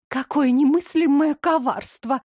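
plain reading voice

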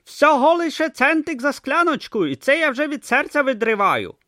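high, loud hawker voice; street hawker Dibbler. Acted. Seller leans in to Brutha, high hawker patter. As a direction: pitch soup like a shifty street trader.

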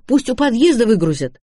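high, insistent objection; бурный протест. A narrator's reading. Лота бурно протестует против заноса. (настойчиво, высоким голосом)

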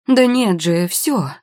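worked-up mother; hysterical mother. A narrator's reading. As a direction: contradict in frazzled frustration. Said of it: "истерящую мамашу".

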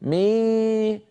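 mock the mistaken مي before شيء; imitating a wrong reading. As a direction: imitate students who say مي instead of a hidden nasal. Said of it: "إيش هذه الأصوات يا أخي".

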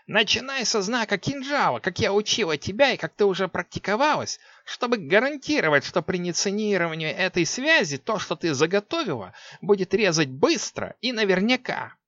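high instructing witch voice; sorceress voice. A narrator's reading. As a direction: teach like an old witch. Six instructs.

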